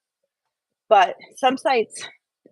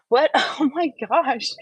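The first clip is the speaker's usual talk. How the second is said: breaks into a real laugh, pitch jumping higher; 5 semitones higher, 3 dB louder than usual. Real, not acted. Laughing at Jason distracting her on the treadmill. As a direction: laugh it off while being distracted. Jason's trying to get me to fall.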